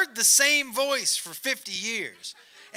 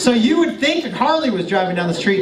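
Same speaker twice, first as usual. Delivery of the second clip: ordinary delivery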